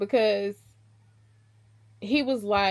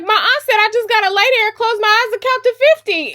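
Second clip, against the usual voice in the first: put-on much higher, louder, fast and girlish; naive nervous bride; 12 semitones higher, 11 dB louder, faster than usual. The naive bride tells her husband her aunt's advice, high and rushed. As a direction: rush it out like a nervous innocent.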